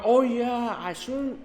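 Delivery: ordinary talk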